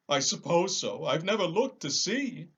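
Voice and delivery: a big cowardly lion protesting; lion, high and loud. Acted.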